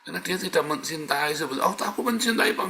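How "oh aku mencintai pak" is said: mock protest. Mocking a listener's protest.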